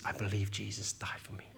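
soft whisper into someone's ear. Retold or imitated. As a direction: breathe it quietly like a secret shared in a hug. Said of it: "he whispers in my ear".

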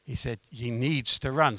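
low, hushed voice in the head; soft and low, like a private instruction; inner voice. Retold or imitated. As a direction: quietly voice an insistent inner prompting. Voice in his head speaks.

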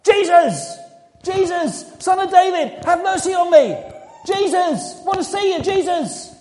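full-force high yell, desperate to be heard; pleading at the top of the voice. Acted. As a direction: bellow each phrase high and hard over a crowd. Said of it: "He shouted louder".

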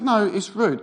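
retold high, insistent objection; quite angry. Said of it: "I'm getting quite angry".

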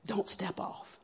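a soft far-off whisper; faint whisper. Retold or imitated. Telling herself on the edge, faint whisper.

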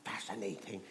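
hushed mock amazement; kids' soft mock-fascinated action line. Shown as a demonstration. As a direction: say it softly as if fascinated. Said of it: "You have to go".

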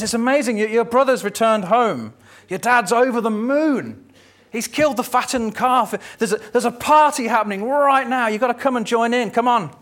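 high, bubbling excitement about the party; excited servant. Acted. Servant [name] urging the older brother to join, excited. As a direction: spill the good news, can't contain it.

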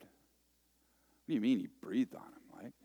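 whispered, slow, baffled; a slow skeptical whisper; puzzled whisper. Retold or imitated. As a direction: hush it like a confused listener.

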